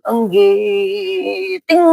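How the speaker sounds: lightly put-on refined court Javanese; a hint of polite keraton speech; mock Javanese palace speech. Preacher mocking Javanese softness, a light put-on court accent. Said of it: "kayak keraton".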